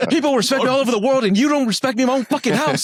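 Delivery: high-pitched, hammed-up indignation; exaggerated wounded-pride outburst; mock temper tantrum. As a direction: play a self-important star throwing a fit. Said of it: "lose your temper one day".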